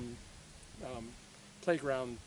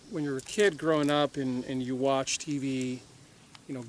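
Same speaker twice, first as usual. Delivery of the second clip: usual speaking voice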